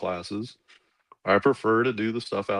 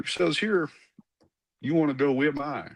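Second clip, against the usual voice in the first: put-on Colonel Waldo's thick Arkansas accent; 7 semitones higher than usual. Colonel calls the cadet into his office, thick Southern accent. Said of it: "really thick Southern Arkansas accent".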